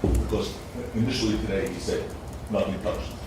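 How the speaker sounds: normal talking voice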